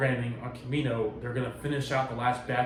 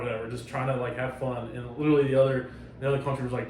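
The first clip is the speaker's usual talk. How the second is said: ordinary talk, nothing marked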